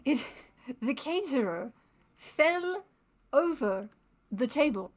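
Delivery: put-on affected, old-fashioned Hollywood darling; hammed-up Hollywood accent, punching the words out loud. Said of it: "the Hollywood accent".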